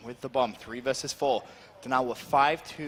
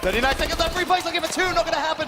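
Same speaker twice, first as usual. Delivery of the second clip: high, loud hype call; excited call; 12 semitones higher, 4 dB louder, faster than usual. Real, not acted. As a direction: ramp up the tension. Rising tension call.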